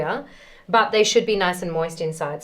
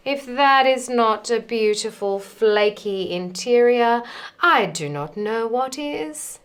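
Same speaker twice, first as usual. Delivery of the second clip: usual speaking voice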